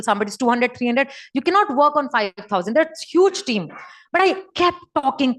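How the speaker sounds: ordinary talk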